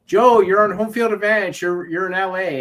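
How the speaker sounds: high, loud imitation of an official; mock announcement. Host imitates someone awarding Joe home field, mock-proclaiming.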